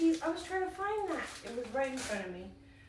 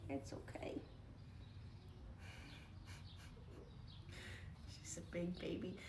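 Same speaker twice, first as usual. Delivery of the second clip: soft unvoiced whisper; 2 semitones lower, 12 dB quieter than usual. Real, not acted. Owner whispering to her upset dog to calm her. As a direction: hush it to a nervous dog.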